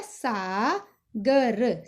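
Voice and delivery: very slow, each letter drawn out; slow spelled out. Shown as a demonstration. Teacher to pupil, very slow sounding out. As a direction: lay the letters out one by one. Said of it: "अगर हम इसकी आ की मात्रा हटा देंगे".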